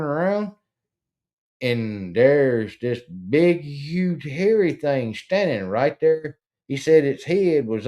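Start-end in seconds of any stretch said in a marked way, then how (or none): none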